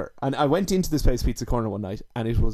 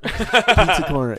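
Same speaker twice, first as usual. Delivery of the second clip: laughing; 4 semitones higher, 5 dB louder, slower than usual. Real, not acted. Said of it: "to say the least".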